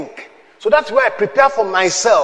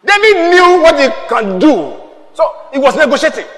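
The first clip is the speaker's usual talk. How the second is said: preaching shout; 6 semitones higher, 4 dB louder than usual. Real, not acted. Preaching at full force.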